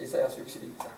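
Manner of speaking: whispered under the breath while turning pages; barely voiced mutter of 이사야서 육십일 장; whispered aside. Real, not acted. Muttering while finding the page. (혼잣말처럼 속삭이듯)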